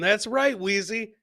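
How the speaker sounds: ordinary talk, nothing marked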